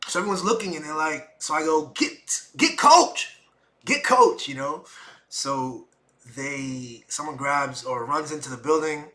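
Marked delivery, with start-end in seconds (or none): 1.9-4.5 loud high drawn-out call; calling for Coach. Retold or imitated. Calling for help.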